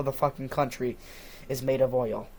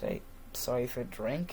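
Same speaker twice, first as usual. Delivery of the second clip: meek apology; 2 semitones lower, 10 dB quieter than usual. Retold or imitated. Child apologising to angry dad.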